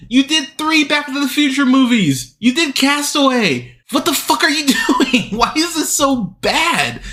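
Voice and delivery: high-pitched, incredulous rant; real high-strung exasperation; exasperated. Real, not acted. Rant at director's bad films.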